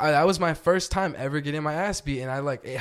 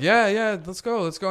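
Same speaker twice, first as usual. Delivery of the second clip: forced eager yes; 6 semitones higher, 3 dB louder than usual. Retold or imitated. Retold forced eager reply.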